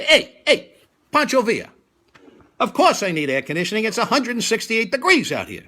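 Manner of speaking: loud, pushy New York yell at the cabbie; half-mock brash New Yorker demand. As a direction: bark it like an impatient New Yorker. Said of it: "resist the urge to say what I'm really thinking, be a real New Yorker".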